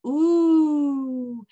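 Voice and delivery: "Ooh" as a long rounded oo vowel; long ooh sound. Shown as a demonstration. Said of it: "O says".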